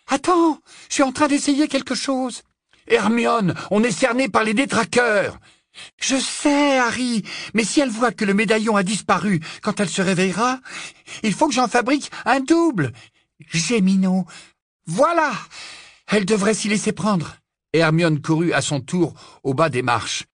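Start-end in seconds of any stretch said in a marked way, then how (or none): 0.1-2.4 put-on very high, harried girl's voice; flustered Hermione. Hermione busy under pressure. 2.9-5.4 loud, high-pitched warning; urgent alarm. Acted. Warning, surrounded by dementors. 6.0-17.4 put-on high, harried girl's voice; exasperated Hermione. Hermione answers under pressure.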